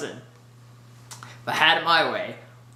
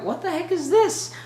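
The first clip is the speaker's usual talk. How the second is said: much higher, baffled question; incredulous squeak; 12 semitones higher than usual. Retold or imitated. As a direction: act stunned by the surprise. Reacting to Tony's new family.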